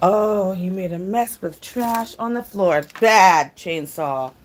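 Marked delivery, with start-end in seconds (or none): 3.0-4.3 scolding. Real, not acted. Scolding dog for trash.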